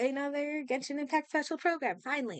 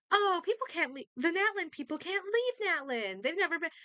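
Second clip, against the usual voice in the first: high, whiny mock of the objectors; mocking imitation; 9 semitones higher than usual. Streamer mimicking players who defend the lore, mocking. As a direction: parrot their complaint high-pitched, dismissively.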